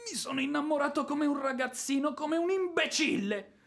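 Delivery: high exasperated self-reproach; despairing outburst. Acted. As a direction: lament your own foolishness aloud. He despairs at falling in love.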